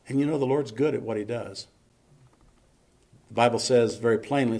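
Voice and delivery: usual speaking voice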